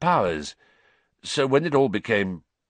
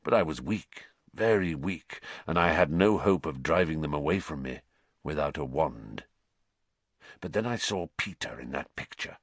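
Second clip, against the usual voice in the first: low, weary escaped prisoner; quiet, haunted man telling his story; low Sirius voice; 5 semitones lower, 5 dB quieter than usual. A narrator's reading.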